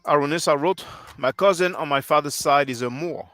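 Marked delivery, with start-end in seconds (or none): none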